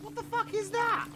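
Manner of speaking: sudden high-pitched shocked outburst; shocked disbelief. Acted. V discovering a fake relic, shrieking in disbelief. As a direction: squeal it in disbelief.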